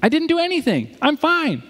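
high, whiny insistence; protesting Job. Retold or imitated. Job insisting to his friends he did nothing, high and whiny. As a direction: plead your innocence up high. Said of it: "He's like".

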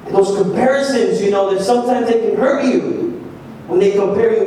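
ordinary talk, nothing marked

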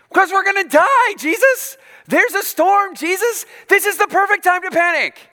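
panicked disciple. Retold or imitated. Frightened disciple yelling at Jesus in the storm, high panicked shout. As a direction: yell it like you think you're about to drown. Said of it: "I'd be like".

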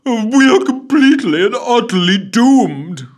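high, loud melodramatic wail of doom; theatrical doom-laden lament; pessimistic doom. A narrator's reading. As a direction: ham up the despair. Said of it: "added somewhat pessimistically".

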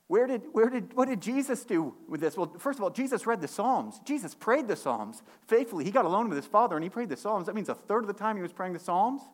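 high, loud building sermon push; preaching at full force. Acted. Preacher rousing the congregation about Jesus praying, full force. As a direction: drive the point home to the congregation.

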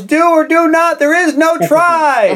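high, slowing proclamation; mock movie quote. Joker answers Nemo with a Star Wars line, mock proclamation. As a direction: deliver it high, stretching the ending. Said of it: "looks at him and goes".

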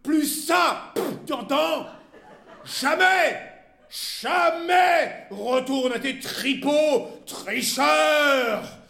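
tirade building to high, loud shouting; furious abuse. A narrator's reading. Wife banishing her husband to his gambling dens, furious abuse. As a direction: hurl each insult, dragging it out at full voice. Wife's final screamed insults.

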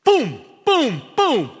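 three high, loud booms, falling in pitch; imitated finger jabs. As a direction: punch out each boom like a blow. Mimics finger drilling into his chest.

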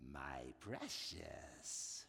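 very low, hissing creature croak of a word; Gollum voice. Retold or imitated. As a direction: rasp it out like a creature clutching a ring. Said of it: "the voice of Gollum".

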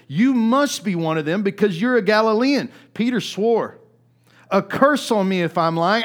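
loud, driving preacher's reading; preaching the Bible story at full force; forceful scripture reading. Acted. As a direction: proclaim the passage to the congregation. Reads the denial passage with force.

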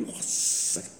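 hushed, breathless wow; awed whisper. Retold or imitated. Listener hearing of the shouting priest, whispering in awe.

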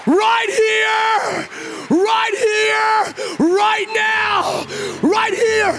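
high, loud cries of amazed joy; ecstatic shouting. Retold or imitated. Blind father crying out that he can see, ecstatic shouting. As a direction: shout it over and over, overcome by the miracle.

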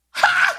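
short, high-pitched "Ha!"; one sudden high burst of delight; triumphant "Ha!". Acted. Triumph at walking on stilts.

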